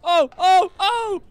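high loud alarmed cries; startled shout of alarm. Real, not acted. Spotting machine gun turret.

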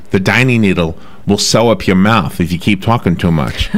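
usual speaking voice

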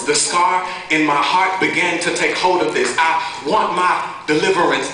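insistent, building slam-poetry delivery; urgent rhythmic testimony; spoken-word poem. Acted. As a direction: press forward line after line, riding the rhymes.

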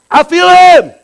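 high, loud proclamation; declaring it loud and high; shout. Real, not acted. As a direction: ring it out to the room. Preacher shouting in worship.

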